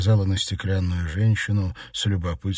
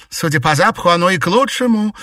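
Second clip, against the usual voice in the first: high, loud and cutting; shouted retort; 12 semitones higher, 8 dB louder than usual. A narrator's reading. Mistress shouting back at her maid, scornful. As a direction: yell the comeback into the next room. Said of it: "крикнула в ответ Алёна Александровна".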